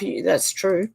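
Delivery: ordinary delivery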